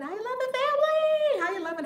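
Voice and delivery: very high, sung-out call to everyone; gleeful high whoop of hello; exuberant greeting. Real, not acted. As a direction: build to a loud, high holler of welcome. Calls greeting to whole chat.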